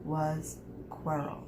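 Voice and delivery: plain reading voice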